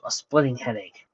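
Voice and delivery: ordinary delivery, nothing marked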